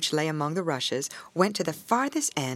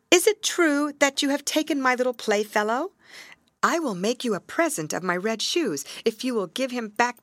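put-on higher, earnest child's plea; pleading little girl; 6 semitones higher than usual. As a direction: beg the river gently. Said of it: "Gerda pleads to the river".

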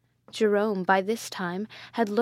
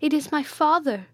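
higher, louder anguished cry; desperate plea; 6 semitones higher, 4 dB louder than usual. A narrator's reading. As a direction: beg them to spare him. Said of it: "cried Matilda".

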